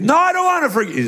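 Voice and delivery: high, quick objection; mock protest. Reluctant listener objecting to praying forgiveness, mock protest. Acted objection to the second prayer.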